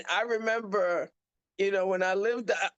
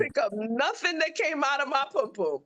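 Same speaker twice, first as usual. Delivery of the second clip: sassy comeback; 6 semitones higher than usual. Retold or imitated. Mom's punchline to the aide.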